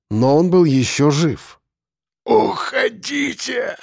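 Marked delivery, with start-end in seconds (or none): 2.3-3.8 very slow, high, strained croak; agonized rasp. A narrator's reading. Crushed man begging them to leave, agonized rasp. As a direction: force it out in a drawn-out hoarse cry. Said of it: "хрипел он, сжимая кулаки".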